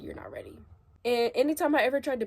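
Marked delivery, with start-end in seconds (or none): 0.0-0.6 much lower, soft, dismissive dad voice; imitating dad. Dad telling her she's not ready, in a low dismissive voice. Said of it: "he was like".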